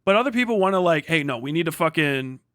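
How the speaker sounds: ordinary delivery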